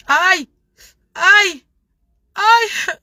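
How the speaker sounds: high, loud acted yelps; loud mock cries. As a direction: cry out in pretend excitement. Sudden cries in pretend lover's call. (cris aigus et forts, joués)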